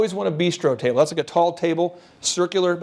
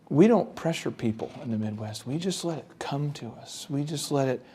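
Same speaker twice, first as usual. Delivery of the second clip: much softer and lower, a laid-back mock Midwesterner; mock Midwestern hush; 4 semitones lower, 7 dB quieter than usual. Mocking Midwestern booth staff who won't pitch, softly and low. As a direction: murmur it like a passive Midwestern seller. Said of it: "some of you Midwesterners like myself".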